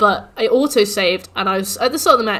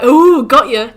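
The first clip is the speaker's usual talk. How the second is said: mocking game; 7 dB louder, much slower than usual. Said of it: "it was like".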